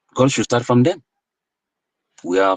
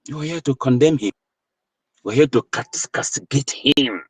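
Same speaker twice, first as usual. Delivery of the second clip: ordinary talk, nothing marked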